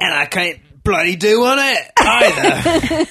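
high, badly faked Aussie accent; bad Australian accent. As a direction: attempt Australian and botch it. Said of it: "that's your accent?".

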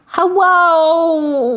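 put-on high, little-girl phone hello; a high baby-voiced hello. Shown as a demonstration. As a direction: answer the phone like a tiny child. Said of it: "I do answer the phone like this".

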